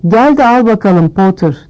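plain reading voice

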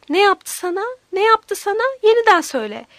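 alarmed questioning. A narrator's reading. Aunt learning the child was abused, alarmed and urgent. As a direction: press the child urgently for the truth.

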